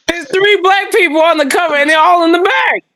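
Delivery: laughing, high shout. Real, not acted. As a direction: yell it half laughing. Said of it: "Calm down, Rosa Parks".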